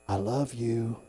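low, soft assurance; tender, as God. Acted. God speaking to a man in a bad mood, tender and low. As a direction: say it gently and quietly. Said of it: "You know how he says it to me?".

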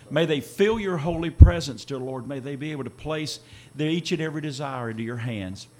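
ordinary talk

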